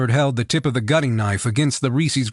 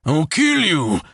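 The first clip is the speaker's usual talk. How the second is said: growled threat; 3 semitones higher, slower than usual. A narrator's reading. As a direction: spit the threat at him, beaten but defiant. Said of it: "the man growled".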